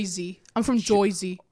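put-on Jersey accent, "oy" vowel in "Jersey". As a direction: announce yourself like a Jersey local. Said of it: "I guess there's, like".

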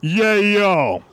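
drawn-out catchphrase impression; Shinsuke Nakamura. Host answering the name prompt, drawn-out mock impression. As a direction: stretch both syllables in a silly voice. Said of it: "Shinsuke Nakamura".

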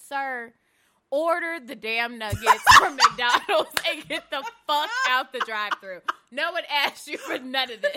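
loud, indignant telling-off broken by laughter; mock exasperated scolding. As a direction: scold him loudly, half laughing.

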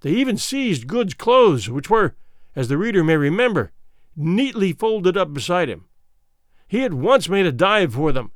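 plain reading voice

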